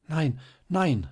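high-pitched, hasty refusal; hasty reply. A narrator's reading. Marie refuses to wait for the officials, hastily. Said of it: "sagte Marie rasch".

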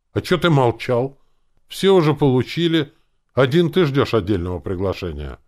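loud, high, indignant retort; counterattack. A narrator's reading. Flustered uncle turning the blame on complainer, indignant. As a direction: go on the attack, loudly. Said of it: "смутился дядя Фома и сам перешёл в атаку".

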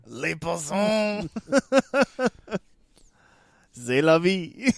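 mock French. Friend joking about the film's French, slow put-on French. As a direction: drawl it like a cartoon Frenchman.

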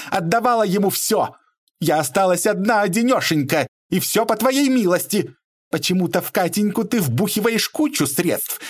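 high, aggrieved outpouring; indignant tirade. A narrator's reading. Mother defending herself and accusing Bobrov, high indignant tirade. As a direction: keep the pitch way up, full of grievance. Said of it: "взвилась дама".

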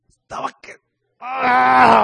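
loud shout of rage; angry yell. Acted. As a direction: let out a furious cry. Jonah's anger exploding.

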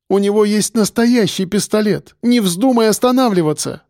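high-pitched alarmed insistence; urgent warning. A narrator's reading. Woman warning the driver about a real gun, urgent. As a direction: warn him with rising alarm.

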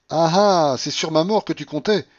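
high, triumphant indignation; loud accusation. A narrator's reading. Babouchka to the general about inheritance, accusing loudly. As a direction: catch him out at full voice. Furious woman exposes the general.

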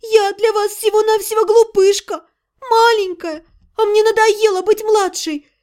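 high, crying complaint; crying sister insisting she is grown up; tearful. A narrator's reading. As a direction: plead through tears, hurt. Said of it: "заплакала Тария".

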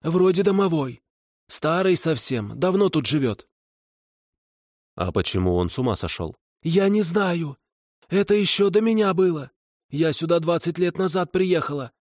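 high hag voice; kikimora voice. A narrator's reading. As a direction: tell what she knows, uneasy. Kikimora explaining.

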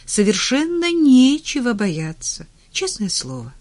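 high, loud start dropping to an earnest close; smiling reassurance. A narrator's reading. Doctor insisting to her patient all is fine, cheerily. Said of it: "улыбаясь".

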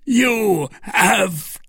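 high, anguished, drawn-out cry; slow, pained wail; mortally wounded. A narrator's reading. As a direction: gasp it out as if stabbed. Duke just stabbed.

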